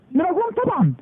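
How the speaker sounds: high-pitched, emphatic obviously; emphatic answer. Real, not acted. Preacher answering his own question to the crowd, high and emphatic.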